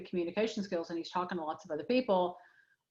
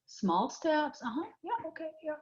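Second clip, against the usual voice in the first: higher, softer soothing murmurs; gentle coaxing; 8 semitones higher, 3 dB quieter than usual. Pretend. Parent gently encouraging a nervous teen to open up, softly. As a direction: coax it out like calming a baby deer. Coaxing a shy child like a deer.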